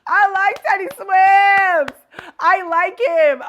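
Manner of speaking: slow, loud, high-pitched declaration; delighted cheer. Real, not acted. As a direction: belt it out, stretching the words. Delighted about a favourite singer.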